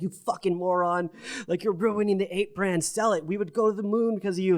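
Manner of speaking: high mock-exasperated scolding voice; angry Discord crowd. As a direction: imitate the annoyed ape Discord. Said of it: "they'd be like".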